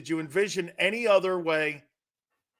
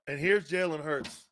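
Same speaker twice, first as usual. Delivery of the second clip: softer, flat letdown introduction; deflated intro; 3 semitones lower, 6 dB quieter than usual. Shown as a demonstration. Host mocking Jalen Hurts, softer deflated intro. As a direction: let the hype drop away on purpose. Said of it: "watch this".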